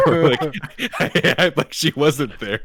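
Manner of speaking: real laughter through the words, slow; telling a friend about ignoring her, laughing. Real, not acted. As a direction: giggle while confessing the prank. Laughing at own prank.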